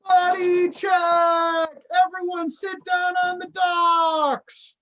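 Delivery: loud, high, slow call-out; lifeguard shout. Shown as a demonstration. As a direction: call it across the waterfront. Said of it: "what would a buddy check sound like".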